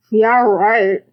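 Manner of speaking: sarcastic. Acted. Brother scoffs.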